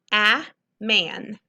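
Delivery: a man with a loud short a; stressed short a, a man; sounding out a syllable. Shown as a demonstration. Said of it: "there's an N after the A".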